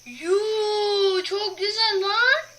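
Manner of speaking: high, loud, slow delighted shout; amazed shout. Real, not acted. Gamer shouting in amazement at the map. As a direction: marvel out loud.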